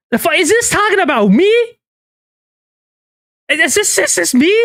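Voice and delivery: real shock at being talked about; shocked disbelief. Real, not acted. Streamer realizing the leaked messages are about him, shocked high disbelief.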